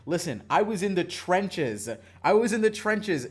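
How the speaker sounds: loud, high, emphatic insistence; punchy, hammed-up protest; mock-dramatic declaring. Real, not acted. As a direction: proclaim it like a war veteran's testimony. Mock-dramatic boast about enduring cringe.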